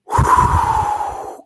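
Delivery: long, breathy blown-out sigh; heavy exhale after getting worked up. Real, not acted. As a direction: let out a big calming exhale. Calming down after the rant.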